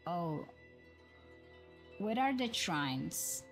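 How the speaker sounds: usual speaking voice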